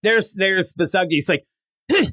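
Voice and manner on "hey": high-pitched stammering comic boss; mock boss in distress. Boss with a stomach ache, high mock complaint.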